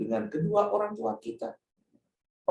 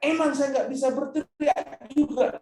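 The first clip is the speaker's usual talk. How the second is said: high, raised mock retort; mock shouting back; 11 semitones higher than usual. Parent yelling back at shouting child, mock retort. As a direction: snap back like an angry parent. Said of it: "kalau kita juga berteriak".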